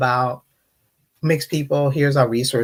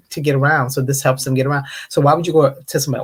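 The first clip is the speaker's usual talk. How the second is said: usual speaking voice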